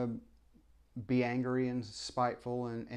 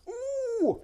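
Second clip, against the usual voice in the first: ooh. Real, not acted.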